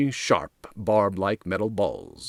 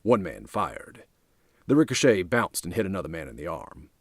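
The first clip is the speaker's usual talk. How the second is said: usual speaking voice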